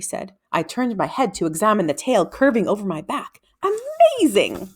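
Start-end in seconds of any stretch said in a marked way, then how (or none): 3.6-4.7 amazed exclamation. Acted. Boy marvels at tail.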